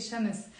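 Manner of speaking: Shams said as sha-mis; two-syllable Shams. Shown as a demonstration. Teacher showing how they say it, two syllables. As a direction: slip a short vowel before the final s of Shams. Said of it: "I even say it".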